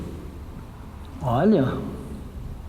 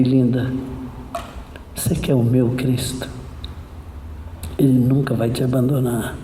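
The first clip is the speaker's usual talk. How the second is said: lower, gentle invitation after the outburst; tender appeal; 6 semitones lower, 5 dB quieter than usual. Retold or imitated. Preacher offers Christ to the crying girl, tenderly. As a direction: softly reassure her.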